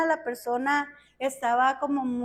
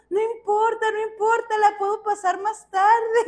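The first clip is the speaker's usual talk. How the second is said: high, louder dismissal of an objection; urgent insistence; 7 semitones higher, 5 dB louder than usual. Retold or imitated. Therapist telling the pastor her session can wait, urgently insistent. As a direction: brush it aside eagerly at a raised pitch.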